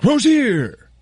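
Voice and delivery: hammed-up sportscaster cry of "Rozier"; big mock announcer call on "Rozier"; Josie. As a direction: stretch "Rozier" out, leaping high on the end. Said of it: "pronounce it like Josie".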